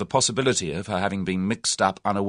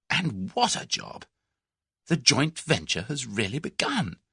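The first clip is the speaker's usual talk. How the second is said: put-on higher, breathless young woman in awe; thrilled young woman; 8 semitones higher than usual. A narrator's reading. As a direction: gasp it out like a dream come true. Tuppence thrilled about the job.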